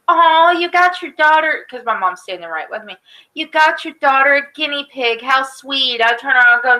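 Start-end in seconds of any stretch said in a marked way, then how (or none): 0.1-1.6 sweet lady. Retold or imitated. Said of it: "this woman looks down and goes". 3.3-6.0 sweet lady. Retold or imitated. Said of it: "this woman looks down and goes".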